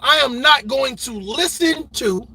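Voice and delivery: ordinary talk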